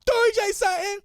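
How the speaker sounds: put-on very high, loud little-girl shout; fired-up small child; child repeating boldly. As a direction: yell it back in a kid's squeal. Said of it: "And she repeated me".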